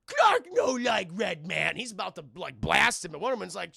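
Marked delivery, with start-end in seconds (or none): 0.1-1.8 angry caveman Superman. Acted. To the League, dim Superman roars a furious threat. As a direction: bellow it like a wounded beast. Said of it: "Superman is like".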